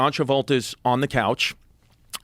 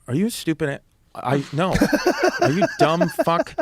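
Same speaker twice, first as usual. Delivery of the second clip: incredulous retort; 6 semitones higher than usual. Retold or imitated. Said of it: "And I was like".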